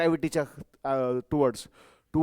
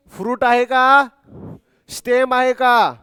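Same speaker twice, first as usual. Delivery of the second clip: higher, louder, slow questions to the class; teacher quizzing class about the root; loud quiz; 4 semitones higher, 7 dB louder, slower than usual. Real, not acted. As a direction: call out the question to wake them up. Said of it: "quizzing class loudly".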